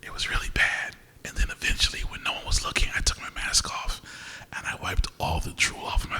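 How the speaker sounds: hushed conspiratorial whisper. Retold or imitated. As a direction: whisper it like sharing a secret. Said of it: "whispering the sneaky part".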